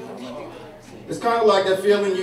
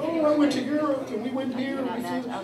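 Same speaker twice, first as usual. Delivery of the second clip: higher, softer snooty classmate bragging; a hint of a spoiled kid showing off; lightly mocking rich-kid brag; 7 semitones higher, 7 dB quieter than usual. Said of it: "some people are like".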